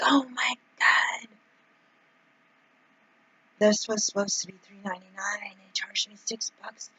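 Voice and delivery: whispered, horrified at the receipt; shocked whisper. Retold or imitated. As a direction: breathe it out in a stunned whisper. Retold shock at home receipt.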